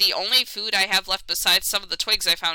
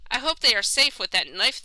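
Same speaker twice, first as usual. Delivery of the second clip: normal talking voice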